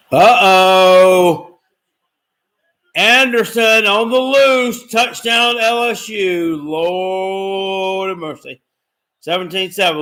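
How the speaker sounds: high, loud, slowed outburst at the touchdown; excited game reaction. Real, not acted. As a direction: call the play like it hurts.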